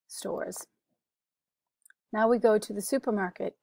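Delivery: usual speaking voice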